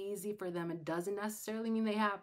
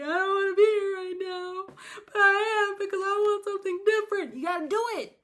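mock crying; 11 semitones higher, 11 dB louder than usual. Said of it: "Sometimes I'm crying and I'm doing it".

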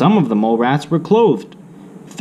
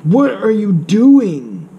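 higher, slow, baffled question; puzzled mole rat; 10 semitones higher, 3 dB louder, slower than usual. Acted. A mole rat questioning the dressed Wilbur, slow baffled voice. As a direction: ask it like you can't believe your eyes.

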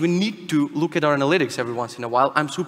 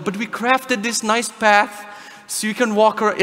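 mock developer; 7 semitones higher, 3 dB louder, slower than usual. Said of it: "developers are like".

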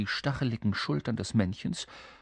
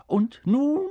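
high, loud creature cry; calling out; 13 semitones higher, 7 dB louder than usual. Acted. Little creature sending the king off, calling out. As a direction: call out excitedly in a little imp voice. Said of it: "rief es".

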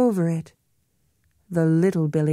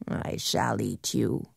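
low troll threat; 8 semitones lower, 8 dB quieter than usual. Acted.

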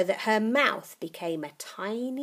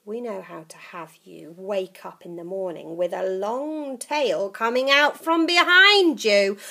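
put-on menacing old witch; 3 semitones higher, 9 dB louder than usual. Grandma menacing.

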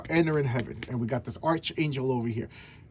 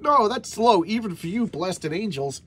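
indignant retort; 7 semitones higher, 4 dB louder than usual. Retold or imitated. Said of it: "And he's like".

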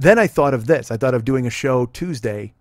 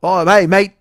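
put-on Australian accent; 4 semitones higher, 8 dB louder, slower than usual.

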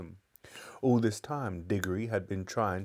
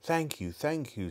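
high, frail and shaken thanks; faint voice; 7 semitones higher than usual. Acted. Shaken old gentleman thanking the policemen, faint and high. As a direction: sound weak and rattled. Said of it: "in a faint voice".

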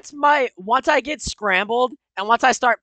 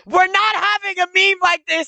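high, raised-voice objection; indignant protest; 6 semitones higher, 3 dB louder than usual. Real, not acted. Player refusing to let the prank become a meme, indignant. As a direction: put your foot down, pitched up.

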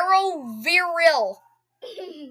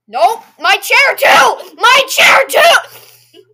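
shouting; 9 semitones higher, 11 dB louder, much slower than usual. Real, not acted. Sister climbs onto his chair.

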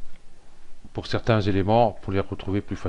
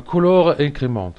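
English words in a French accent, loud and higher; anglais à la française; 7 semitones higher, 8 dB louder than usual. Real, not acted. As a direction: read the English term aloud with a heavy French accent. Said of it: "Excusez-moi de mon anglais". (anglais avec un fort accent français)

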